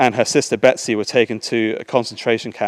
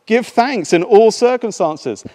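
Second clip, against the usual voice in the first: excited; 10 semitones higher, 6 dB louder, slower than usual. Acted. Betsy eager, found the answer.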